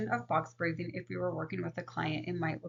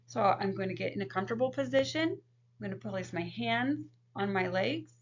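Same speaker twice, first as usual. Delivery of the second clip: guided breathing demo; 3 semitones higher than usual. Shown as a demonstration. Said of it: "I'm gonna go ahead and demonstrate it for you".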